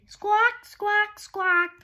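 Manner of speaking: high, loud squawking cockatoo calls; noisy cockatoo squawk. Acted. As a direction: screech it out like a cockatoo. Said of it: "my noisy friend".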